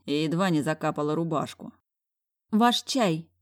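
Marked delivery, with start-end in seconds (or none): 2.5-3.3 a hint of a sniffy snort. A narrator's reading. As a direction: say it with a little snort. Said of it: "фыркнула параша".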